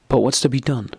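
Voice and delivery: a guilty husband asking helplessly; piteous. A narrator's reading. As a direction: ask it pitifully, lost. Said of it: "in a piteous voice".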